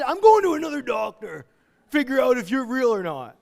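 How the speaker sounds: mock suspicious patient. Patient doubting a healthy diagnosis, mock suspicion. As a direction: ham up doubting the good news.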